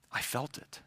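low, near-whispered conviction; hushed certainty. Retold or imitated. Jesus insisting someone touched him, hushed. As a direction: say it very softly, with quiet certainty.

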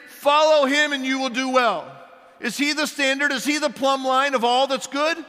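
high, loud pulpit proclamation; teasing preacher praising Pastor Max; mock-grand preaching. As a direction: hold him up as the standard, booming. Preaching at full force.

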